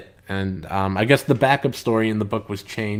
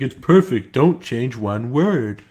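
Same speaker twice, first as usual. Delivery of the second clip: a louder, slow take on a boss; lightly voiced satisfied boss; imitating a boss; 4 dB louder, slower than usual. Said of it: "he's like".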